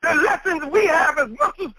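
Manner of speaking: usual speaking voice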